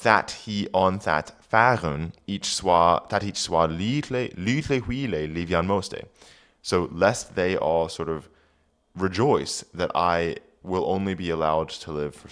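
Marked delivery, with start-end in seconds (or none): 0.0-6.0 put-on reading Old English aloud; careful Old English recitation. Shown as a demonstration. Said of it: "reading Old English aloud".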